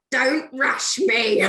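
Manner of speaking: slow, drawn-out grumble through a laugh; grumbling soldiers. Retold or imitated. Troops answering their commander's order, slow laughing grumble. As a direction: lazily push back at the order, half laughing. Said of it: "they're like".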